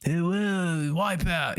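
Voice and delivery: lightly voiced laid-back surfer; a hint of a chill surfer bro; surfer dude. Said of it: "he talks like a, like a surfer".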